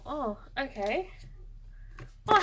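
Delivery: normal talking voice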